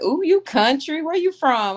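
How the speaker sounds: high-pitched imitation of curious locals; retold nosy surprise. Locals react to her country accent, in mock high-pitched surprise. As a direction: raise it high, mimicking their reaction. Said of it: "They be like".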